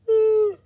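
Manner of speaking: loud, high startled gasp; sharp gasp. Retold or imitated. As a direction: gasp as if it just hit you. Sudden realization of bag.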